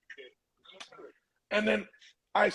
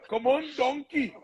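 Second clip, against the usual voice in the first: much higher, hammed-up cartoon ogre voice; Shrek voice; 11 semitones higher than usual. Joking that his friend sounds like Shrek, a hammed-up ogre voice. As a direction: play up a silly Shrek impression for a laugh. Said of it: "It sounds like Shrek".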